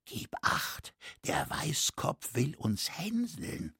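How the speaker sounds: hushed, whispering, drawn out at the end; whispered warning. A narrator's reading. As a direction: whisper it secretly to your neighbour. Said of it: "flüsterte ihm zu". (warnend geflüstert)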